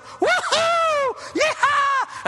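high, loud celebratory whoops; noisy whooping. Acted. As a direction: whoop it up like a man bursting in. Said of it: "suddenly there's this noise".

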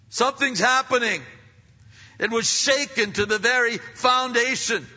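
high, loud, driving preacher's cry; full-force preaching. Acted. Preacher thundering to the congregation about creation shaking.

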